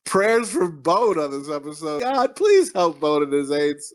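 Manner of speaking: slow, solemn mock prayer; hammed-up plea to God. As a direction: intone it slowly like a preacher. Mock prayer for sick co-host.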